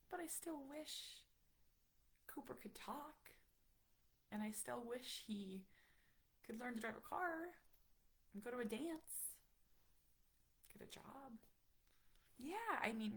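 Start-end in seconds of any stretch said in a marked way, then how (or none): 0.1-11.3 soft, higher, halting wistfulness; real hushed longing; tender sadness. Real, not acted. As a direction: wish for it quietly, with long pauses. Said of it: "that's a really tough topic to talk about".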